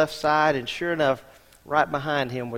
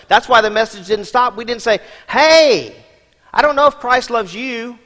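preaching; 5 semitones higher, 8 dB louder than usual. Acted. Preaching at full force.

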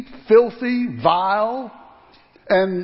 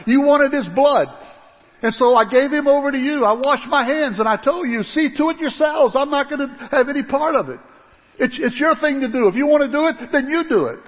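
loud, higher blaming; angry accusation; 5 semitones higher, 3 dB louder than usual. Acted. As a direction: throw the blame at them. Pilate accuses the crowd.

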